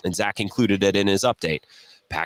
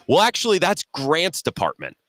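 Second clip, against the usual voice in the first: imitating Bill; 6 semitones higher than usual. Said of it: "Bill, savvy, goes".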